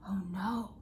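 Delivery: ordinary delivery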